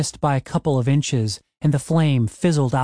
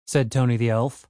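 plain reading voice

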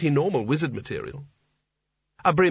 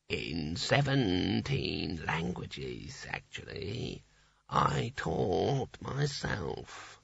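low, slow, near-whispered snake; 6 semitones lower, 10 dB quieter, much slower than usual. A narrator's reading. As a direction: drawl it low like a lazy snake.

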